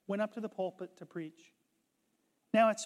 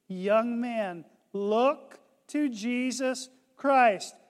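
louder and higher, "Christ" slow and stretched; preacher's shout; 3 semitones higher, 11 dB louder, much slower than usual. Retold or imitated. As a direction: cry out to the stranger in the gallery like a street preacher. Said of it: "the layman preacher shouted".

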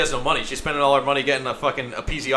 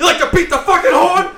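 much higher, louder hammed-up husband yelling; mock furious husband; 8 dB louder than usual. Joking about the couple, hammed-up high yell. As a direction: bellow it like a raging husband.

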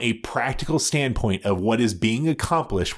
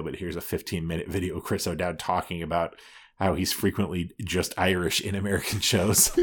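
usual speaking voice

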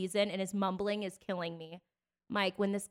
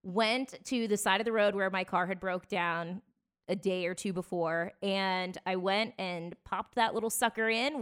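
ordinary talk, nothing marked

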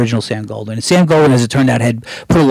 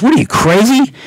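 retold incredulous question, pitch jumping high; 8 semitones higher than usual. Friend hearing he wants to quit the show, incredulous. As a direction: toss it out in high disbelief.

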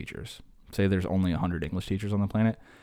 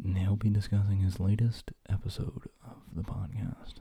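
whispered radio aside. Retold or imitated. Mocking NPR hosts on his own podcast, hushed whisper. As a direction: breathe it like a hushed NPR host. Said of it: "how about the N P R people".

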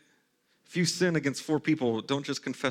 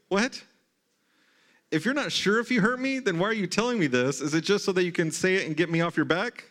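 higher-pitched, affronted questioning; incredulous; 6 semitones higher than usual. Acted. Hurt person answering a weak apology, incredulous. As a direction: push back in mock disbelief, pitched up.

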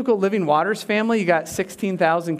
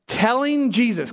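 ordinary delivery

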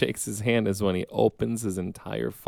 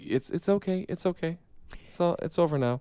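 hushed soothing imitation; 5 semitones higher, 5 dB quieter than usual. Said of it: "that line delivery just".

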